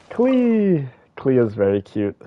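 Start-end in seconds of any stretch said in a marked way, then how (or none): 0.1-0.9 high, delighted cry of a name; light up at seeing her; excited call. Real, not acted. Streamer spots his favourite character, excited call.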